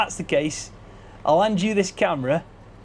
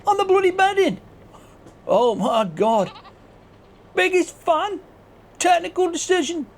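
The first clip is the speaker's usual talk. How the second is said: hammed-up outraged farmer; high outraged character voice; 9 semitones higher than usual. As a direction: shriek at the prank in disbelief. Character shocked, raging at Scruff.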